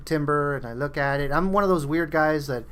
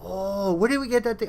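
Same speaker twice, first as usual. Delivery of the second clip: gleeful higher voice; excited recall; 10 semitones higher than usual. Retold or imitated. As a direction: pitch it way up like finding a forgotten treasure. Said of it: "be like".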